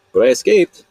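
high, loud burst of triumph; triumphant. Real, not acted. Proudly telling how he got away, triumphant. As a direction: shout the escape proudly. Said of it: "triumphant escape".

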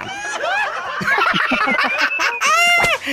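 a shrill burst of laughter; high shrieking laugh. Real, not acted. Host laughing at the caller's answer, high shrieking laugh.

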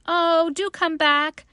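high, loud, drawn-out plea; pleading little rabbit cry; calling out. A narrator's reading. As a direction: call after them, begging them to return. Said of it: "called the little rabbit".

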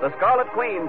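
usual speaking voice

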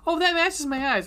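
high, surprised gush; mock surprise. Imagined surprised reaction.

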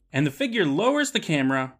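ordinary delivery

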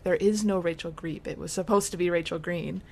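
ordinary talk

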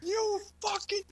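high slow laughing mock yell; angry dad rant. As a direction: rant like a fed-up suburban dad. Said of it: "I'm like".

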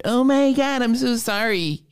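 high-pitched, put-on sympathetic gush; over-the-top "poor you" voice; gushing mock sympathy. Acted sympathetic reply to sob story.

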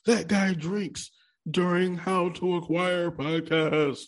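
high, slow mocking viewer voice; mock commenter voice. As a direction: voice a snarky commenter. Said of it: "if you wanna leave that in the comments".

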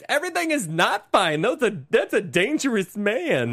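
high, swooping mock melodrama; hammed-up high protest; imitating movie mother. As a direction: parody an overacting mother's alarm. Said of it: "and the mother's hilarious".

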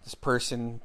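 ordinary talk, nothing marked

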